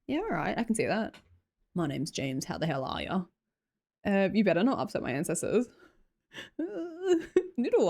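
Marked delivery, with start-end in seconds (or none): 1.7-3.2 low, soft mock intro as a bloke; a hint of a low-voiced businessman; lightly voiced bloke James. Streamer voicing chatter James, soft and low.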